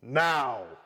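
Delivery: powerful, deep roar; loud low-pitched bellow. A narrator's reading. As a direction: bellow the order with full force. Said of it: "raised his powerful voice even more and roared".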